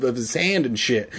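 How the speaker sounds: ordinary delivery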